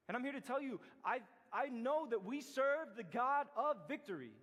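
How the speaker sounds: preacher declaring victory to the congregation; preaching. Acted. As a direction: build the closing to full conviction. Closing sermon build.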